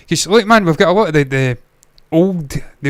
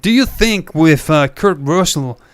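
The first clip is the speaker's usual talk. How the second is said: put-on American accent. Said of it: "I'm just putting on this accent for a moment".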